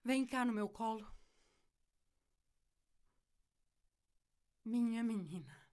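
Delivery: soft, low comforting voice; tender. Acted. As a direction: soothe her gently. Mother comforting after punishment.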